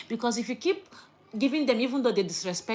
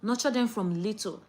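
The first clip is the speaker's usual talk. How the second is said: normal talking voice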